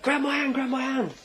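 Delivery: high, urgent mock call; urgent rescuer. Big guy to man on the tracks, high mock-urgent plea. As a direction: act out a pressing offer of rescue.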